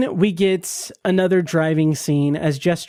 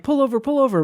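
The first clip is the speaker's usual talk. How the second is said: higher, urgent mock panic; urgent plea; 9 semitones higher, faster than usual. Man in crisis begging Jess to pull over, higher urgent plea. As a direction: imitate a man begging her to stop.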